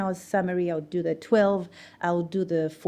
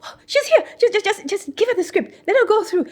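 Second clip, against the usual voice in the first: very high, loud flustered boss; hammed-up relieved panic, pitched way up; frantic boss; 9 dB louder than usual. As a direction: imitate a boss scrambling, stammering through laughter. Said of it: "And then my boss goes".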